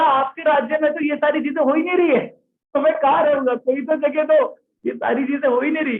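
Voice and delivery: lightly acted, high-pitched complaint; Kali's protest. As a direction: plead your case to the king, pitched well up. Acts out Kali's protest to king. (कली की ऊँची आवाज़ में शिकायत भरी गुहार)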